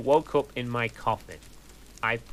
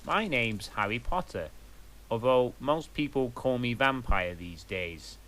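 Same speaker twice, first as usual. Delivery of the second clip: ordinary delivery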